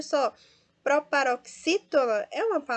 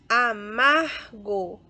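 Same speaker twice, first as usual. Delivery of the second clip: "Amargo" drawn out, MAR louder; penultimate stress; 7 dB louder, much slower than usual. Shown as a demonstration. Teacher sorts words for students, slow "Amargo" stressed on MAR. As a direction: spell out "Amargo" slowly by syllable, hitting "mar". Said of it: "paroxítona também".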